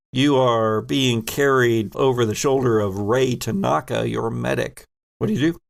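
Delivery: ordinary talk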